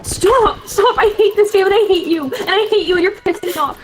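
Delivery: high-pitched, worked-up rant; exasperated outburst. Real, not acted. Gamer raging at teammates during play.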